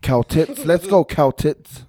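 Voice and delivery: mock team cheer. Cohost rooting for the team as a joke, mock cheer. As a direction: cheer it out slowly, hammed-up.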